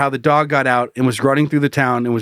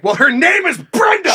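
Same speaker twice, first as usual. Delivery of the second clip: indignant; 13 semitones higher than usual. Pretend. Mocking the offended dog owner.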